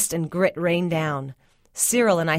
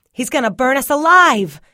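high, loud panicked yell; 7 semitones higher, 10 dB louder than usual. A narrator's reading. Cyril smelling smoke in the cellar, yelled. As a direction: yell in alarm, far above talking pitch. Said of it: "he yelled".

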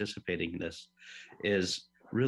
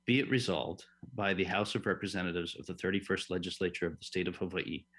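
ordinary talk, nothing marked

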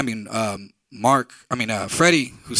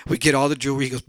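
usual speaking voice

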